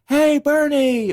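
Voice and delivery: high, drawn-out friendly holler; calling out. Retold or imitated. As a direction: imitate boaters hailing a friend across water. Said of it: "you just hear people calling out".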